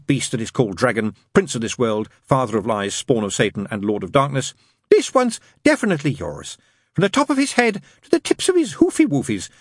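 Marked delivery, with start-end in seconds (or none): 4.9-9.5 very high nun voice; cooing, insistent nun. A narrator's reading. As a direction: coo over the baby up high.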